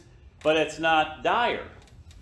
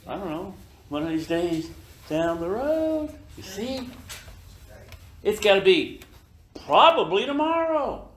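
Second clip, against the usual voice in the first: breezy and offhand, climbing higher at the end; mock casual shrug; 4 semitones higher than usual. Client brushing off the death claim, breezy and offhand. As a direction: play it like a client who couldn't care less. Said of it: "see the casualness?".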